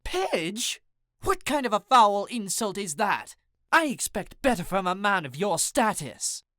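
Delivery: high, affronted British lady voice; dramatic British indignation. A narrator's reading. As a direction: act grandly insulted in a high British accent. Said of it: "She gasped dramatically".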